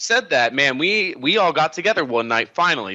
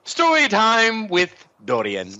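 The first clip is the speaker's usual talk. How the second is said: slow, high mock announcer intro; 8 semitones higher, much slower than usual. Friend pitches a podcast edit, slow mock announcer voice. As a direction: announce it like a cheesy show host. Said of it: "an edit in where it goes".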